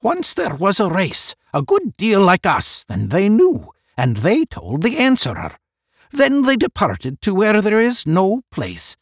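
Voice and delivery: high, loud declamation; proclaiming a legend. A narrator's reading. One recounting legends to the group, proclaiming. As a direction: proclaim the old tale to everyone.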